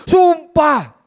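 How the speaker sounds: high, loud, desperate shout; shouted oath. Acted. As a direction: swear it out to deny everything. Petrus swears loudly. (teriakan sumpah keras dan tinggi)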